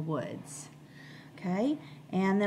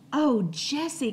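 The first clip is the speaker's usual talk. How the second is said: higher, louder eager exclaim; excited cry; 7 semitones higher, 5 dB louder than usual. Acted. Benny crying out at the blueberries, excited. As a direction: call out in delight. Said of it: "cried Benny".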